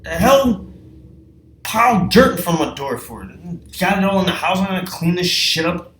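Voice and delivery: loud and high, exasperated ranting; shouting, angry and disbelieving at George; furious yelling. Retold or imitated. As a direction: rant at him for the dirt pile. Yelling at George over dirt.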